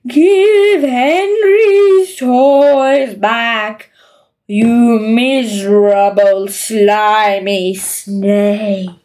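very slow, haunting ghost voice; ghostly rasp. Acted. Henry popping out of Margaret's wardrobe, ghostly rasp. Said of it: "he would rasp".

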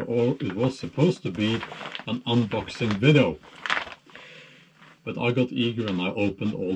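muffled behind respirator; through a gas mask. Real, not acted. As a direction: voice inside sealed mask. Seen 0: Full-face respirator mask worn throughout; no mask in references.

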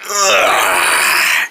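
very low creaky groan; straining groan. Acted. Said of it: "trying to do something nasty".

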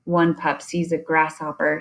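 plain reading voice

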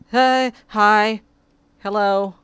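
bright loud hello to the cat; startled loud hello; loud greeting. Real, not acted. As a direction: call out a surprised hi.

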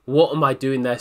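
ordinary delivery

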